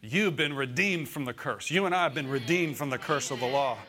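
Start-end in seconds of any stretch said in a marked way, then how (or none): none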